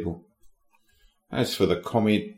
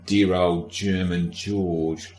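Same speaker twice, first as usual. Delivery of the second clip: put-on dear old German George stretched slow, each word lingered; drawn-out fond mock; much slower than usual. Shown as a demonstration. Podcaster drawls a mock-fond name for the king, slowly. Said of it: "I don't know why I said dear old German George".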